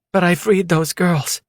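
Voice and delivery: a woman insisting she did good; woman's voice. A narrator's reading. Female character voiced by narrator.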